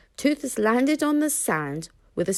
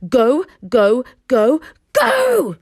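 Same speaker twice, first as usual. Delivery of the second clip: loud, rising frantic scream of go; screaming command; 4 semitones higher, 9 dB louder, slower than usual. A narrator's reading. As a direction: scream it, growing more frantic. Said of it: "screamed Hiccup".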